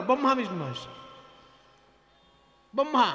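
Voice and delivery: ব্রহ্মা said "Bomha" with no r; ব্রহ্মা as "Bomha", r left out of the cluster; r-phala dropped. Retold or imitated. Mimicking the friend reciting the gods without r. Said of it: "ব্রহ্মা রফলা বলে".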